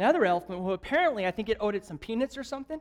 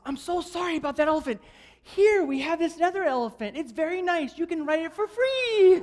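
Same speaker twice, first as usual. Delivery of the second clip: panicked park owner; 7 semitones higher than usual. Retold or imitated. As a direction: gush out the apology like a flustered owner. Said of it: "the owner's freaking out".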